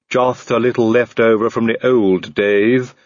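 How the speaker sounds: slow servant voice with a light cheerful lift; cheerful Igor. A narrator's reading. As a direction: reassure them brightly, in no hurry. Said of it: "said Igor cheerfully".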